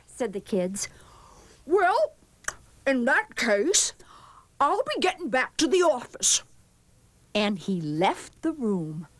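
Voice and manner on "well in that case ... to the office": higher, put-on principal voice; lightly voiced school principal. A narrator's reading. Principal handing the class back, lightly put on.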